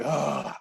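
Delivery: loud, imitated sigh of annoyance; loud exasperated sigh. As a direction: let out a big, put-upon groan of a sigh. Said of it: "like, a loud sigh".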